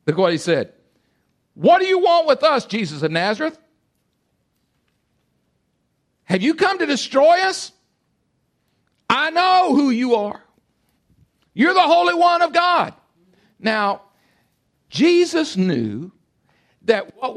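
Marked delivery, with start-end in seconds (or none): 1.5-12.9 high, loud confrontational cry. Acted. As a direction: cry out in challenge at a higher pitch. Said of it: "cried out".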